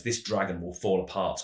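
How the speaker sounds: ordinary talk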